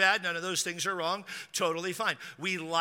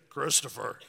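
lightly imitated deep-voiced dad, a bit lower; dad's radio voice; 4 semitones lower than usual. Dad waking to his crying son, lightly imitated deep radio voice. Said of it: "he's got a radio voice".

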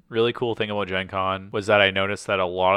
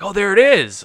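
high, louder exclamation; retold excited discovery; 11 semitones higher, 6 dB louder than usual. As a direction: call it out on spotting it. Retold spotting of the booth.

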